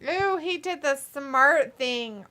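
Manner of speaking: usual speaking voice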